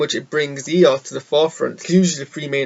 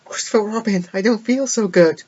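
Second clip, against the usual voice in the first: higher, fading Infinity War line; dying farewell; 8 semitones higher, 3 dB louder than usual. Retold or imitated. Pooh quoting the Infinity War farewell to Robin, higher and wistful. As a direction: say it like a hero turning to dust. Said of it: "the former mind was like".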